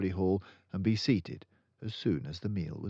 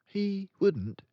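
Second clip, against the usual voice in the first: put-on lifted high, slow girl's voice; a calm, certain young girl; Matilda's voice; 5 dB louder than usual. Matilda correcting her friend, in a high girl's voice.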